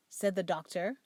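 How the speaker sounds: ordinary delivery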